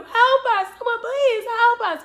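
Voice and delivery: high, loud cry out the window; imitated screaming for help. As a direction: scream for help like a frightened sister. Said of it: "screaming out the window".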